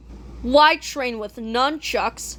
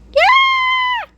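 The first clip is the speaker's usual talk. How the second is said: cheering shout; 4 dB louder than usual. Acted. Heroes cheer.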